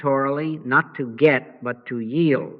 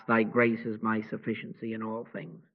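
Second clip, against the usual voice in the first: much softer and lower, almost to oneself; hushed mantra; 4 semitones lower, 10 dB quieter than usual. Shown as a demonstration. Guide speaking the mantra softly as the self gives way. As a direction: breathe the words under the breath.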